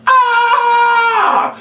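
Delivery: very high, loud "ah" yell; demo shout. Shown as a demonstration. Entertainer showing the boys how to shout, yelling. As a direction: belt out the shout for them.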